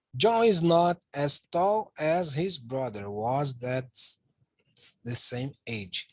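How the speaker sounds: very slow, careful English sentence; slow model reading. Shown as a demonstration. Teacher reading the negative example to students, very slowly. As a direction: spell it out slowly for the learners.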